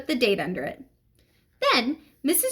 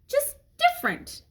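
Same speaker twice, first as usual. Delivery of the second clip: high pleading. Acted. Child defending his word.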